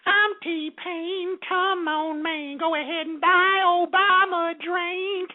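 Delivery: high, slow [name] impression. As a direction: lightly mimic a [name] drawl. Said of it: "is [name] doing campaign stumping".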